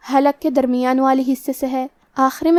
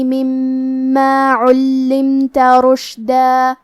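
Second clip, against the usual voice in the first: slow, louder chanted tilawat; Quran recitation; 3 dB louder, much slower than usual. Acted. Teacher recites the verses for students, slow chant. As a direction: recite the verses slowly, melodic.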